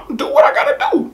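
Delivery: laughing through a broken voice; cracking laugh. Real, not acted. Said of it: "Look, I lost my voice".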